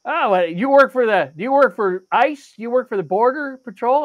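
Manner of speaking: retold eager questioning; prying stranger. Stranger quizzing him in the checkout line, prying.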